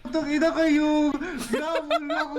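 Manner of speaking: high, slow laughing yell; laughing scream. Real, not acted. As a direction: shriek it out while laughing. Jump scare in game.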